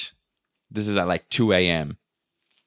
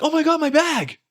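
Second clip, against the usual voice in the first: high, louder squeal of discovery; excited cry; 4 dB louder than usual. Retold or imitated. Girls spot their bag at the carousel, excited cry. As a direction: imitate two girls thrilled to spot luggage.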